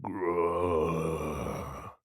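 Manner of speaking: low, drawn-out zombie growl; low grumbling zombie grrr. A narrator's reading. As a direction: growl it low and slow like the undead. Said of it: "less than eloquent".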